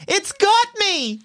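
put-on high, drawn-out little girl's cry; acted terrified girl's shriek, held back; mock scream. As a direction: scream it slow and high without much volume. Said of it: "She looks at you screaming".

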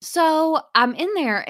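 usual speaking voice

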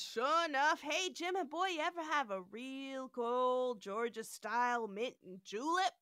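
a genial southern host offering a drink; southern accent. Retold or imitated. Mock southern McCoy offering Kirk a julep. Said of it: "my southern accent".

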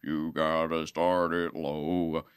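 low, slow grunge-country voice; start it low. Retold or imitated. Bartender coaching her to start the song low and slow. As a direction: drop the voice down low and drag it. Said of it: "He goes".

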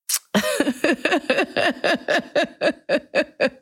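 high burst of real laughter; bright giggle at own joke; laughing. Real, not acted. Laughs at her own sex joke.

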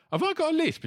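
high-pitched incredulous question; retold question, voice shooting up; disbelieving question. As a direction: act out asking in sheer disbelief. Acts out anxious question to wife.

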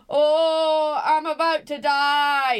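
stretched-out, hammed-up moan of doom; granddad's lament. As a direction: imitate a granddad wailing theatrically. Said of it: "'cause he always said".

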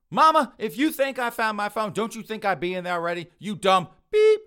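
high, very loud exasperated outburst; angry yelling. Acted. Son yelling back at his mother about his lost phone, angry. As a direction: yell back, furious at being asked.

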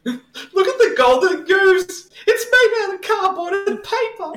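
very high, squealed mockery; mock exclamation. Host mocking the cheap golden goose prop, high hammed-up squeal.

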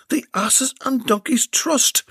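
high, slow and lively; animated. Acted. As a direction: savour each word with delight. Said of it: "replied an equally animated Belinda".

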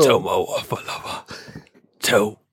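slow, strained farewell; wounded soldier gasping. Acted. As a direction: say it with your final breath. Dying pig's last words, acted.

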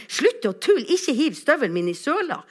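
put-on high, loud child's protest; acted protest. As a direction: plead loudly with a teasing friend to stop. Friend protests in acted quarrel. (høy, ivrig protest som et barn)

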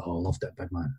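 hushed, low-pitched quote of a pleased friend; quiet retold approval. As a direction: retell his approval low and soft. Retold phone verdict from Yogi.

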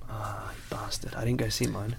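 hushed whisper. Real, not acted. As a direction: whisper it guiltily. Mock whisper about mum.

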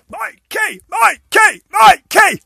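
high, rising yells of the name; calling out. Real, not acted. Calling out to the nephew.

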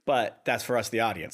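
usual speaking voice

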